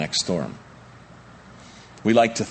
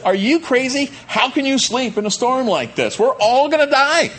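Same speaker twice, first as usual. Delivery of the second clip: much higher, louder frantic yelling; panicked shriek; 5 dB louder than usual. Acted. Preacher acting disciples shouting at sleeping Jesus, frantic. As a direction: scream it over the storm, sure you'll die.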